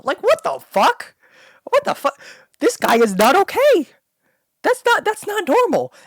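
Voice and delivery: high, loud, disbelieving shriek; a real high-pitched outburst of disgust; outraged shriek. Real, not acted. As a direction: scream out your outrage at the character. Outraged reaction to the film.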